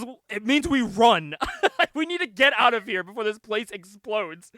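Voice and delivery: loud, high, breaking into laughter; hammed-up urgency, cracking up; laughing mock alarm. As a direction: shout the escape order through laughs. Acting out escape order while laughing.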